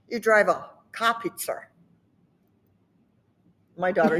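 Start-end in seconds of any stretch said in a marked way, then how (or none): 0.1-1.6 Boston 'car' without R, 'pizza' with one; Boston accent. Shown as a demonstration. Mocking how they do this, Boston accent. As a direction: swap the R's like a Bostonian, 'cah peetser'. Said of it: "put R on that one and take the R off".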